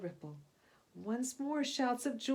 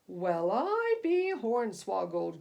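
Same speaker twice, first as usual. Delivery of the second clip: put-on slow, loud, gruff old sea captain; growling captain; 7 dB louder, slower than usual. A narrator's reading. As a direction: growl it out like a salty skipper. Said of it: "growled the captain".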